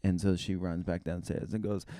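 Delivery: normal talking voice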